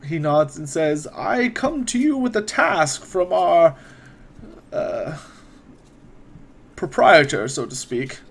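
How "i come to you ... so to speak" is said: put-on lightly raised, measured old wizard; a hint of a courteous court wizard, unhurried; wizard NPC voice.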